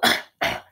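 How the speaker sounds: sharp dry cough. Acted. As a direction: cough from the smoke. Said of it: "Holly coughed".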